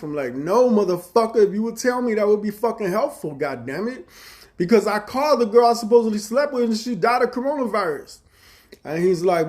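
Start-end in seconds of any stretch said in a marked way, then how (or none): 0.3-8.2 much higher, louder exasperated rant; retold angry reply. Arguing with brother-in-law about the girl, loud exasperated rant. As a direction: vent it like you're fed up.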